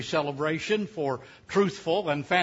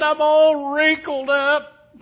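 slow, high, louder falsetto lament; Fanny Victoria's voice; 10 semitones higher, 9 dB louder, much slower than usual. Retold or imitated. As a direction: draw it out high like a wailing old wife. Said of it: "She said".

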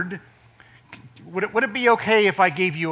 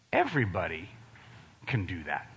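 quiet emphasis; 10 semitones lower, 11 dB quieter, faster than usual. Acted. Preacher drops to a hush telling the congregation everybody can. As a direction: drop it soft and low, almost confiding.

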